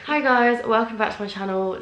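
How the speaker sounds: high, loud opener with a laugh; bright vlog greeting. Real, not acted. Vlogger greeting her channel viewers, excitedly. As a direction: burst in with a cheery hello.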